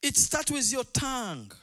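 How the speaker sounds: loud, high shouted sermon; fiery revival preacher, full voice; preaching at full force. Acted. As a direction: shout it out to the whole congregation.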